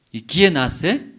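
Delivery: ordinary talk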